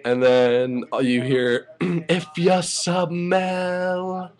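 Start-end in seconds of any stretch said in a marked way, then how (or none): 1.8-4.3 high, drawn-out catchphrase imitation; very slow, held high notes; the Rock. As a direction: stretch it out like a wrestling entrance call. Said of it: "new tweaked version of the Rock so-, theme song".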